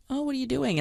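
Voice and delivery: high, light casual greeting. Retold or imitated. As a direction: chirp it offhandedly to someone in the kitchen. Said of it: "they're like".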